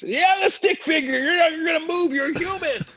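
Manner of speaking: hammed-up furious director shouting; mock director yell. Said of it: "I'm getting yelled at by the director".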